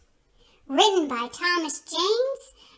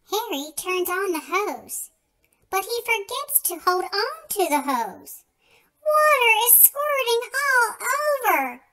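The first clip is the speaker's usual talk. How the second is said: usual speaking voice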